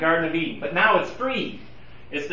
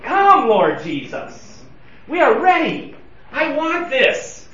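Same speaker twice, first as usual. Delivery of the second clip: drawn-out plea, rising higher; crying out; 6 semitones higher, 3 dB louder, slower than usual. Acted. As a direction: cry out slowly as the longing church. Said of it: "in unison throughout history cry out".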